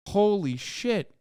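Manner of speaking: ordinary talk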